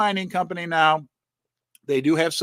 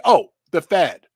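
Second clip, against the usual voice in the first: normal talking voice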